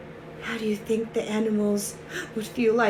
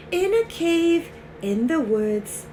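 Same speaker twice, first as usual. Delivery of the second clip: plain reading voice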